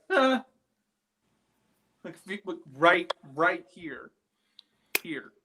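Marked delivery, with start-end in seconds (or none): 0.1-0.4 short high-pitched "ah"; imitated female voice. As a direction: imitate a woman's brief, high vocal sound. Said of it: "It was, like, female voice".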